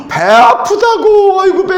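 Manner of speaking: imitating the cousin groaning in pain, slow and high; mock groan of pain. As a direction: wail it like a boy clutching his stomach.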